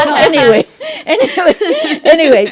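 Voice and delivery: laughing it off, repeating anyway; breaking up with laughter mid-sentence. Real, not acted. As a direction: brush the joke aside through real laughter. Laughs off her own joke.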